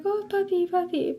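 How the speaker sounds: high, light imitation of an excited small child; little kid voice. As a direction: mimic a toddler spotting a dog, up high. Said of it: "little kids always point at Ash, like".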